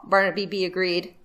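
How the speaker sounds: usual speaking voice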